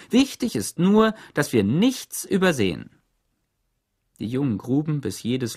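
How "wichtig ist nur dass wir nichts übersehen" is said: bossy Warden. A narrator's reading. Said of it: "wiederholte die Chefin".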